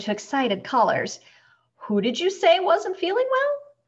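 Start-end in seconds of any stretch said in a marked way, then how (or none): 1.8-3.7 high-pitched doctor soothing a caller; a doctor's reassuring question, pitched up and projected; comforting bedside voice. A narrator's reading. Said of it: "his usual comforting bedside voice".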